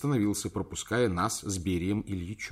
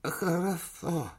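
put-on high, slow toothless old man; toothless mumble; 9 semitones higher, much slower than usual. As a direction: mumble through no teeth. Old chairman voiced.

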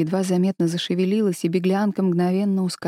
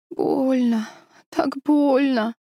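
high, slow delirious moan; feverish; 6 semitones higher, much slower than usual.

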